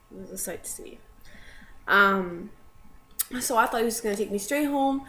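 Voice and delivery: ordinary talk, nothing marked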